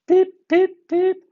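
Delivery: very high, loud cheeky pips; little engine cheekily answering Gordon. A narrator's reading. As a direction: tease right back at him. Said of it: "replied Thomas cheekily".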